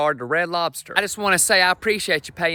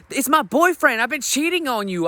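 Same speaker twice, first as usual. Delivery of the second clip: high wife voice; 9 semitones higher than usual. Retold or imitated. Comic voicing the wife confessing the affair in a high voice. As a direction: blurt it out as the wife.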